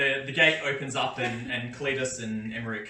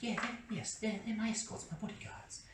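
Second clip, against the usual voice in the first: higher, softer, timid merchant voice; a nervous little trader greeting the guard politely; meek trader; 8 semitones higher, 11 dB quieter than usual. Acted. Skooma Joe answers the gate guard from the cart, higher soft timid voice. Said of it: "He's like".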